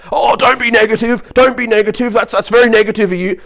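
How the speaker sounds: high, loud parody of a positivity nag; hammed-up high-pitched reproach; mock scolding. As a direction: squawk it as an exaggerated telling-off. Mimicking scolding positive people.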